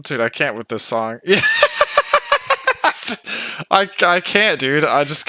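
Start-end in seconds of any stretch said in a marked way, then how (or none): 1.4-3.7 long, very high cackling laugh; helpless laughter. Real, not acted. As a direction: lose it completely over the song. Can't cope with the song.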